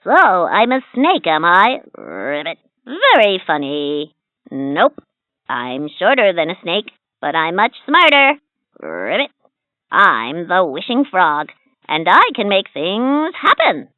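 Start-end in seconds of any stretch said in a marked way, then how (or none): 2.0-2.6 low, soft ribbit croak; frog croak. Acted. 2.9-8.4 lightly croaky talking frog, pleased with himself; a sly little frog who likes to show off. Acted. Frog character voice. 8.8-9.3 low, soft croaked ribbit; a deep little frog croak. Acted. 9.9-13.9 lightly croaky talking frog, pleased with himself; a sly little frog who likes to show off. Acted. Frog character voice.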